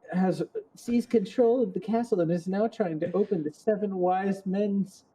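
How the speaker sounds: high, pleading princess voice; high helpless damsel plea; Zelda voice. Acted. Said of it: "Now you have to give her the whole".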